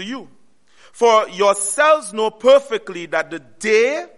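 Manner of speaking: slow, measured King James reading; scripture reading. Acted. Preacher reading Thessalonians to the church, slow and solemn. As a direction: read the verse with weight.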